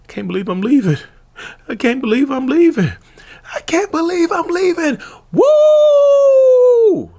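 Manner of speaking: mock celebration climbing from high voice to squealing whoop; hammed-up hype building to a falsetto cheer; getting hyped. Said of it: "They get hype".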